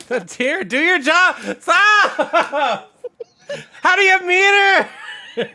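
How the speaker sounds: loud, high frustrated shouting; yelling at the game. Real, not acted. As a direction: shout at the screen in disbelief.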